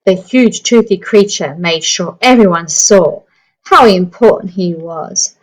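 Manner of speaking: lively storybook narration for a class; reading to children. Acted. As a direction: read it like story time on the carpet. Reading picture book aloud.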